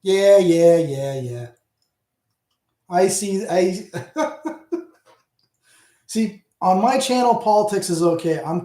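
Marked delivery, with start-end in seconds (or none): none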